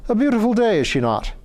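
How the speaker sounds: high cheerful Irish lord; Irish accent. Acted. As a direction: savour the weather, in a lilting Irish voice. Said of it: "He spoke with an Irish accent".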